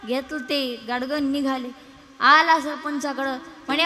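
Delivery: usual speaking voice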